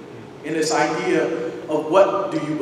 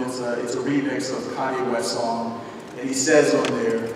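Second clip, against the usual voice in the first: ordinary delivery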